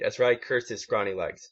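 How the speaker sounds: plain reading voice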